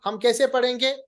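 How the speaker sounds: ordinary delivery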